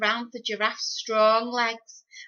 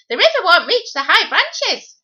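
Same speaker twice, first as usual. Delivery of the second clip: high, loud call from the treetops; monkey calling; 10 semitones higher, 12 dB louder than usual. Acted. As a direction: shout it down from up high. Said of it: "called the monkey from the treetops".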